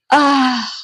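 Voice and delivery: loud, drawn-out gasp into a question; slow, loud, incredulous; shocked disbelief. Real, not acted. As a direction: blurt out the number, astonished. Finds series has fifty-nine books.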